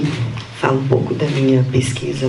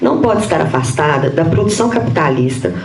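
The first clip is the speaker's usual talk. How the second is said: higher-pitched, measured read-aloud voice; formal paper reading; 6 semitones higher than usual. Real, not acted. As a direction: read out a prepared academic text. Reads her prepared paper aloud. (leitura formal de texto acadêmico)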